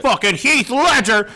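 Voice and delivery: high, loud burst of disbelief; mock outraged yell. Acting out past casting outrage.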